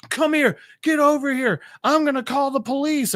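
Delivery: high, indignant ordering; mock angry demand. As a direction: imitate a furious driver demanding obedience. Angry driver threatens Avery.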